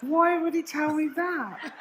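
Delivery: high, slow, exaggerated disbelief; mock disbelief. Real, not acted. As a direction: stretch it out in outraged amazement.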